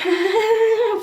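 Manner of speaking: short high laugh of disbelief; disbelieving laugh. Real, not acted. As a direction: laugh at the absurdity. Finding the station closed.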